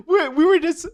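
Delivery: high, loud words through laughter; real giggling, voice jumping up; laughing. Real, not acted. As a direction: try to talk while cracking up. Cracking up at coffee story.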